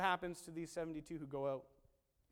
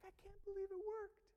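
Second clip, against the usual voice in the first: high, contained squeal of disbelief; acted high-pitched amazement; hushed excitement; 11 semitones higher, 4 dB quieter than usual. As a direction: marvel in a high voice, held back from shouting. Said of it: "They came back all excited".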